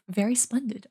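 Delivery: ordinary delivery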